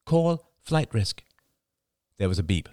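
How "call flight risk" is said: slow, high, deliberate command; higher, measured words for voice recognition. A narrator's reading. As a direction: enunciate it slowly for a phone. Voice command to the phone.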